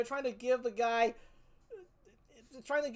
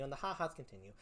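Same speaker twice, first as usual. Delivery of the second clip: ordinary talk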